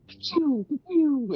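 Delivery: high finger-gun laser sounds; high, falling "pew" shots; pew pew noises. Acted. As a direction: fire imaginary finger guns with your mouth. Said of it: "and just go".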